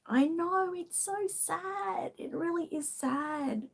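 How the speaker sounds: fighting back tears, voice breaking; teary. Real, not acted. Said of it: "Sorry, I'm a little bit teary".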